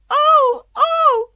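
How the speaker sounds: high, very loud cries of "oh"; loud screams. A narrator's reading. Queen screaming before she is pricked, loud screams. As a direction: scream out in sudden pain. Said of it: "began to scream very loudly".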